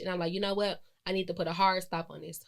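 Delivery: ordinary talk, nothing marked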